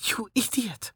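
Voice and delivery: soft tearful hiss of a scold; choked whisper. A narrator's reading. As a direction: whisper the insult through tears. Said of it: "a hitch in her voice".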